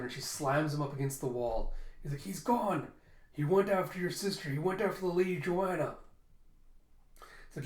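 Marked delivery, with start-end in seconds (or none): 2.2-6.0 high, scared and pleading; panicked high answer; frightened pleading. Acted. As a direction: blurt it out, pinned to the wall. Pinned man answers fast.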